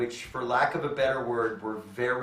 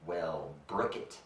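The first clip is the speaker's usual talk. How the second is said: low, slow, softer deadpan; dry comeback; 8 semitones lower, 9 dB quieter, slower than usual. Retold or imitated. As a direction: drop it flat and cool. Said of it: "I said".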